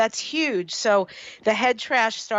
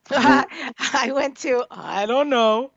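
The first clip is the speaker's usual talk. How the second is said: laughing; 4 semitones higher, 3 dB louder, slower than usual. Real, not acted. Laughing at quiz question.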